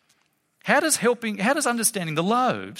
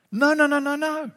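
high, insistent run of no's; emphatic no; 8 semitones higher than usual. Real, not acted. To listeners who see only miracles, insistent no. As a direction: cut the idea off, pitched up.

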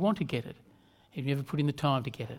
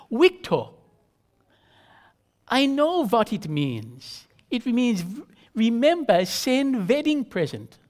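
put-on higher, slow wife in a thick Central European accent; 6 semitones higher, 3 dB louder, slower than usual. Wife explaining RSVP to husband Victor, thick Central European accent.

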